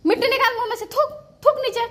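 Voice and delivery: very high, loud command to spit it out; sharp shout. Real, not acted. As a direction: get the dirt out of her mouth now. Making toddler spit out dirt.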